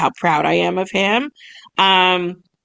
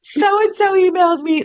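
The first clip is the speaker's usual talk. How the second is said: excited squeal; 11 semitones higher, slower than usual. Retold or imitated. Telling the girls about the email, squealing with joy. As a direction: burst out with joyful news. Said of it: "And I was like".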